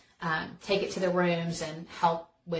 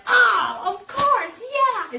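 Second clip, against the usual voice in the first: much higher and louder, over-the-top mock despair; hammed-up dismay; 9 dB louder, much slower than usual. [name] overplays her sock mistake for Kevin, mock dismay. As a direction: ham up the letdown at the wrong socks. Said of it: "'cause I'm so dramatic".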